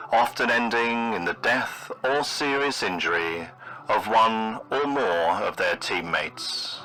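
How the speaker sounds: ordinary delivery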